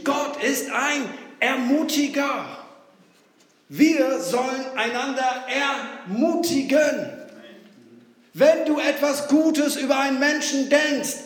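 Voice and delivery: rising to a loud, fervent call; loud preaching. Real, not acted. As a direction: preach it with growing force. Prediger steigert sich ermutigend. (laut predigend, immer eindringlicher)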